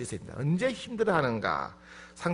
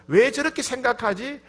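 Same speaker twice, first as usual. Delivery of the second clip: high, loud exasperated questions; mock indignant grumbling, pitched up; complaining, blaming; 6 semitones higher, 5 dB louder than usual. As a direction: complain as if you can't understand them. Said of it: "이렇게 불평하고 원망은 하되".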